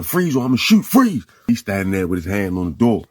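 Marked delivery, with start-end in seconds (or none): 0.0-1.2 raised, high police warning; acted yelling. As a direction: build to a loud final command. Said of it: "They get to yelling".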